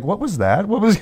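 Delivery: confused band member. Retold or imitated. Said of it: "the band comes up like".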